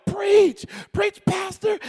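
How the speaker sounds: high-pitched congregant's holler, imitated. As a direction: mimic a wife hollering from the pews. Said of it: "she started hollering".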